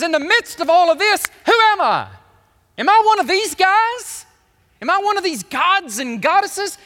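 high, loud, searching question; voicing Jesus. Jesus asking disciples amid pagan statues, high and loud. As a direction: challenge them with who am I.

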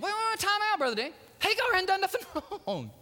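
objecting listener. Retold or imitated. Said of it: "some of you are going".

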